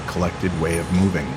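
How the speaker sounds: plain reading voice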